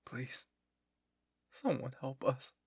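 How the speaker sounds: understated broken plea; voice cracking, breaking down. A narrator's reading. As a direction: plead as if starting to break down. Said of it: "His voice cracked as he broke down".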